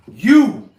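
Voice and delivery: sudden loud bark "You!"; retold angry shout; yelling. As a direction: explode at him out of nowhere. Biker suddenly yells at Martin.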